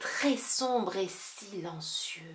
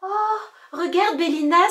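high, loud excited call; excited cry; 9 semitones higher, 10 dB louder than usual. Acted. Boy finding his lost doudou, excited cry. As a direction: shout it out in delight. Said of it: "s'écria Abel".

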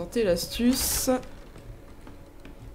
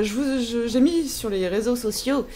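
ordinary talk, nothing marked

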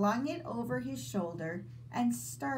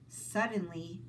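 ordinary delivery, nothing marked

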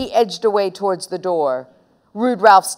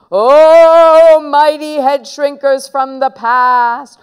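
loud, drawn-out, higher-pitched conjuring; incantation; 5 semitones higher, 8 dB louder, slower than usual. Acted. Boy invoking head shrinkers of the past, grand slow incantation. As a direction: summon the spirits in long, booming vowels. Boy chants a mock magic curse.

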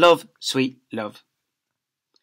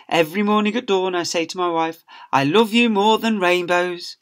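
lightly voiced doting father; 5 semitones higher, 4 dB louder than usual. Father declares love.

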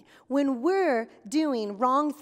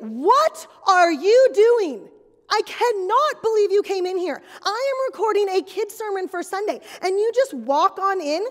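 loud, higher, indignant scolding; outraged; 5 semitones higher, 7 dB louder than usual. Acted. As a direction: tell off the intruder, furious. Acted outrage at intruder.

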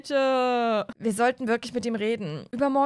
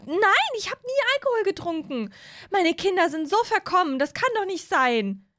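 higher, indignant mom to her husband; higher indignant mother complaining to husband; upset mom; 8 semitones higher, 3 dB louder than usual. Acted. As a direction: vent to your partner about the kids.

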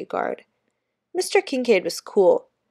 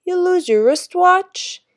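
Jamaican guard, slow and much higher, louder; teasing kid with a Jamaican lilt; Jamaican accent; 11 semitones higher, 7 dB louder, slower than usual. A narrator's reading. Mister Kincaid's lines.

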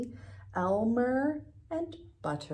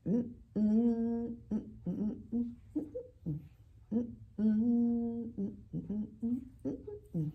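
yummy mm sounds; 3 semitones higher than usual. Pretend. Teacher pretending to eat, yummy hums.

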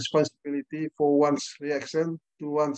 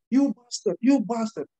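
put-on angry child insult; 5 semitones higher, 3 dB louder, slower than usual. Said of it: "Apa dibilangnya".

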